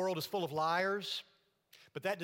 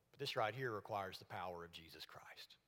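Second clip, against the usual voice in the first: hushed whisper; 7 semitones lower, 7 dB quieter than usual. Real, not acted. Preacher drops to hushed emphasis.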